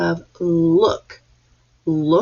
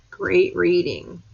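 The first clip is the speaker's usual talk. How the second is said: usual speaking voice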